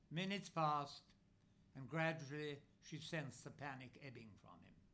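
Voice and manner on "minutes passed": plain reading voice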